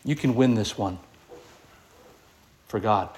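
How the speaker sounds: low, soft inner voice urging you on; quiet encouragement. Acted. Holy Spirit speaking inside us, quietly encouraging. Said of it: "the Holy Spirit is encouraging us".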